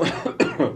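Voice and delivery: sudden choking cough; choking on a drink. Real, not acted. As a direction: cough as if a sip went down wrong. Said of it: "desculpa, me engasguei".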